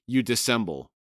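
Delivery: normal talking voice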